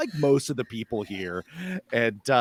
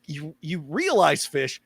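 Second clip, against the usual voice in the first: ordinary talk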